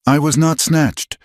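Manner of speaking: high, sharp denial; cutting and firm. A narrator's reading. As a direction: cut through firmly. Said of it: "Her voice was not loud, but it cut".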